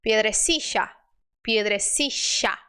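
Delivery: "piedrecilla" with LL as SH; SH sound for LL. Shown as a demonstration. Teacher showing the last option, SH "piedrecilla". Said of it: "é o S H".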